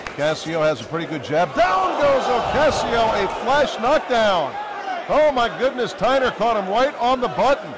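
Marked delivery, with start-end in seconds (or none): 1.6-7.7 excited commentary. Real, not acted. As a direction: call the knockdown like a shock. Knockdown in the fight.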